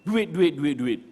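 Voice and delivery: loud, irritated repeated demand; mock angry snap. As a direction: snap the word at her like a fed-up husband. Husband snapping at wife. (tengkingan suami yang marah)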